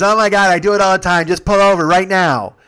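loud, high whining outburst; impatient demand. Retold or imitated. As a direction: act out a passenger demanding relief. Ron demands he pull over.

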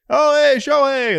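high, loud and slowed hey to a neighbour; drawn-out, beaming hello to a passing star; friendly Midwest greeting. Retold or imitated. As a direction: greet him like a cheerful local. Imagined Midwest fan greeting Ohtani.

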